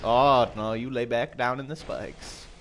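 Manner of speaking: ordinary talk, nothing marked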